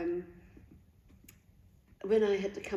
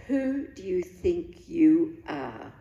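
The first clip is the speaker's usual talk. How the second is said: slow, louder, weighty challenge; voice of God rebuking; 6 dB louder, slower than usual. Retold or imitated. The Holy Spirit confronting the speaker, slow and louder. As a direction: let each word land like a divine rebuke. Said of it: "and just said".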